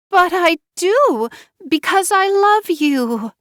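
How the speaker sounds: high, slow, teary plea; tearful plea. A narrator's reading. Wife crying to husband, high tearful plea. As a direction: beg through tears like a hurt child.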